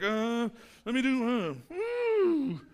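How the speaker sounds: high drawn-out "woo"; wavering, then a high cold-water whoop; hesitant testing. Acted. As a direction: ease in with a squeamish high hoot. Acting a hesitant swimmer testing water.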